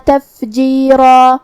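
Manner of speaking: slow melodic tajweed recitation; Quran recitation. Shown as a demonstration. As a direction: chant each verse slowly with held vowels. Recites the verse after the rules. (قرآن کی آہستہ، سریلی تلاوت)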